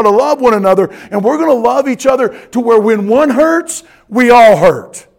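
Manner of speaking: loud, high-pitched preaching that drives onward; fervent full-force preaching. Acted. Pastor urging members to love one another, preaching fervently.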